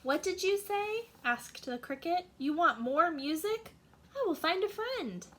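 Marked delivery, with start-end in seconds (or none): 0.0-1.1 a small cricket, high and puzzled; bright high little cricket asking. Acted. 2.4-5.3 high, eager little cricket voice; cheerful cricket. Acted.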